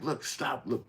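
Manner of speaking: urging her. Retold or imitated. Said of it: "everybody's like".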